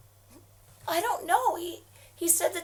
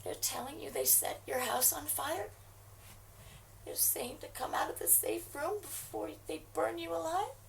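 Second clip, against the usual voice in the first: ordinary delivery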